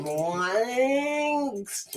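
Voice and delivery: "Blanks" high and soft; mock "Blanks" quietly up high; mocking accent. Shown as a demonstration. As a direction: say "Blanks" high with a pinched vowel. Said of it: "Sounds like shit".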